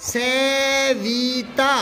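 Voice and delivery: Savita drawn out syllable by syllable; teacher dictating Savita very slowly; slow dictation. Shown as a demonstration. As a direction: spell Savita out slowly for the class to write. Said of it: "ये नीली पट्टी पे लिखो".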